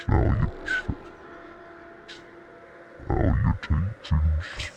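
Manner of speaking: deep, slow filtered demon with whistling s; low demonic growl through a voice effect; demon voice with tooth whistle. Shown as a demonstration. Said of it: "do the demon voice and tooth whistle".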